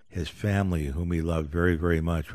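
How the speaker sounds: ordinary talk, nothing marked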